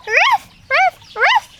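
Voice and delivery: high loud mock barks; high yapping ruffs; excited puppy barks. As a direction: yap happily at the gift. Dogs bark at the presents.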